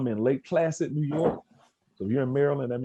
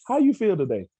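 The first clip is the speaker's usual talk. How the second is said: higher and louder, cheery teacher asking; bright, louder question; 9 semitones higher, 6 dB louder than usual. Retold or imitated. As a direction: call it out brightly to the kids. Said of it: "Guess what happened?".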